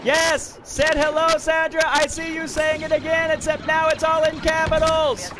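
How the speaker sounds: beam out a welcome to a returning viewer; excited greeting. Real, not acted. Greeting chatter Sandra excitedly.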